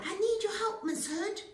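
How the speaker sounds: high, loud pleading piggy; a desperate pig asking for help; pig begging. A narrator's reading. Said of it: "Pig cried".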